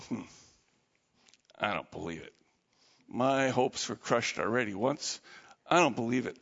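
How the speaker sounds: low, soft doubtful voice; doubtful grumble. Acted. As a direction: grumble with disbelief. Doubting Thomas refuses.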